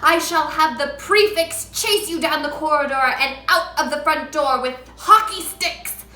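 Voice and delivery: shouting. A narrator's reading. Trunchbull shouting insults at Matilda. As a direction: pound out each insult.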